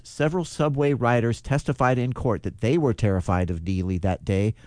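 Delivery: usual speaking voice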